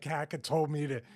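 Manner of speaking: normal talking voice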